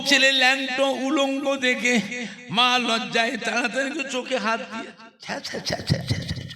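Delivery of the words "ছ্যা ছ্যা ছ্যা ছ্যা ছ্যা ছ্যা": breathless hushed tsk-tsk of shame; a mother clicking her tongue, voice dropped to nothing; unvoiced whispered tutting of a shamed mother; shocked tutting. Mother shamed at naked son.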